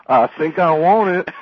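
slow, high southern innocent; Dewey Cox, naive. Retold or imitated. Dewey gives in on the final line, slow naive drawl. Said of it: "It's so cute".

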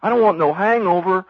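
high, loud naive southerner; Dewey Cox, very naive. Retold or imitated. Dewey worries about reefer's effects, very naive southern voice. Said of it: "like, very naive".

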